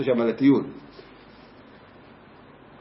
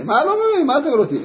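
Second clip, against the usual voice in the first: confused outburst; 11 semitones higher than usual. Retold or imitated. Teacher confused.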